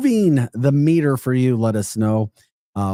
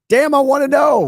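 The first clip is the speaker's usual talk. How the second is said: loud, high-pitched demand; exasperated outburst; 8 dB louder than usual. Real, not acted. Host demanding why Memorex came up, exasperated shout. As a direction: burst out demanding an answer. Mock outrage about Memorex mystery.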